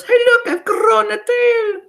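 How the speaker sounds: witch mimicking a yelling girl; very high, loud squeal in rhyme; mock yell. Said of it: "She yells".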